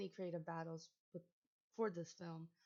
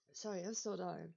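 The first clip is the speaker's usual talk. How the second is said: struggling to breathe; catching breath after choking; 2 semitones lower than usual. Real, not acted. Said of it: "I'm trying to breathe".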